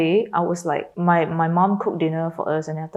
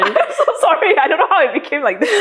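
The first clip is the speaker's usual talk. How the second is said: higher, quick laughing sorry; giggly embarrassed apology; 11 semitones higher than usual. Real, not acted. Apologizing while teary and laughing.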